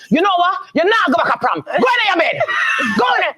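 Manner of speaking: high angry shout, laughing at the end; furious. Retold or imitated. Mother grounding her son on prom night, angry high shout. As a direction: order him off to bed, pitch way up. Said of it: "and then I was so mad".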